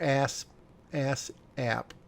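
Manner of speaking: ass app with a short A, like "ass" plus "app"; joke pronunciation. Shown as a demonstration. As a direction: try out ass app in two short-A pieces. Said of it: "That'd be funny".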